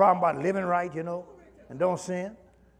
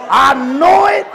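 loud, high preacher's cry; preaching at full force; 9 semitones higher, 7 dB louder, slower than usual. Acted. Preacher urging the church, at full force. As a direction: drive the exhortation home like a pulpit call.

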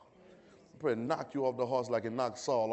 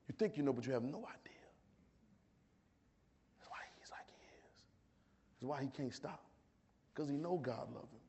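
hushed aside; 11 dB quieter than usual. Real, not acted. Preacher confiding why he can't stop, hushed. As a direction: let it sink almost to a whisper. Preacher drops to near whisper.

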